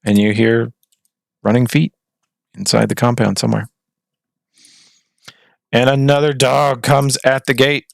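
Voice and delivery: ordinary talk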